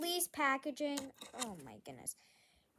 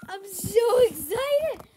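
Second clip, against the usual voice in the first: slow, drawn-out squeal of excitement, higher and louder; so excited; 6 semitones higher, 12 dB louder, much slower than usual. Real, not acted. Vlogger gushing to viewers about her package, slow high excited squeal. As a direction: stretch it out, bursting with excitement.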